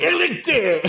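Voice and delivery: a failed try at a Scottish "Alistair", cracking up; bad Scottish accent. Host laughs at her own bad Scottish accent while greeting the listener. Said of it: "I can't do a Scottish accent".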